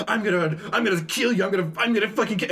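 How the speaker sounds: high, frantic, rushed threat; acted death threat. Fake Uber driver threatens passenger, frantic mock rage. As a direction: stammer out a hammed-up rage.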